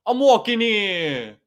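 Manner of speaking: slow, loud New York "I'm walking here" bark, high; hammed-up New York street yell; New York accent. Interviewer teasing the New Yorker, mock New York accent. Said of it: "you crossing the road and you go".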